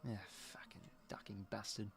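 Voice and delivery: hushed, breathless whisper of a swear; whispered curse. Real, not acted. Fan at home cursing the rival under his breath. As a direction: spit it under your breath. Bitter aside at rival player.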